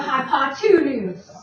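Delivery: "hypotenuse" with TWO punched in the middle; two stressed inside word. Shown as a demonstration. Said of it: "I'm gonna say it that way".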